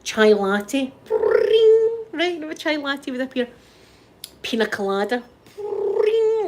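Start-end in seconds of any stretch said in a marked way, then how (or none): 1.1-2.1 high sung ding of magic; magic bring sound. Retold or imitated. Dream superpower making a drink appear, high magic chime. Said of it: "like that". 5.6-6.5 high sung ding of magic; magic bring sound. Retold or imitated. Dream superpower making a drink appear, high magic chime. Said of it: "like that".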